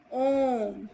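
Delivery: ओम held out very long, the vowel stretched; chant-like drawn ओम; prolonged vowel. Shown as a demonstration. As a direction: draw out ओम three times as long. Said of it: "तिगुना समय लगता है उसे पलू स्वर".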